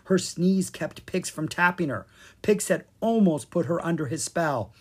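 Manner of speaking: ordinary delivery, nothing marked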